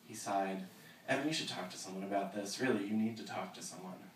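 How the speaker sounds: steady reading of a novel aloud; story narration. Acted. Author reading her novel to the audience, measured narration.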